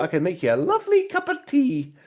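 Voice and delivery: high, chiding barmaid voice; tavern owner voice. Acted. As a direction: scold him like a prim landlady. Lara voice.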